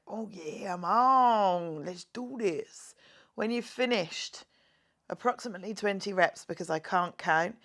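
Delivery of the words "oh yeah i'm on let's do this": a lightly voiced eager core, slowing at the end; playful core pep talk, a mild put-on voice; voice of your core. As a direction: give the core a keen little character voice. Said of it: "your core feels like".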